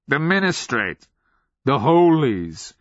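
put-on crazed old sergeant's lifted voice; mad old man. A narrator's reading. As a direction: draw out the names with relish. Old sergeant answering with relish.

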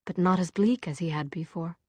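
plain reading voice

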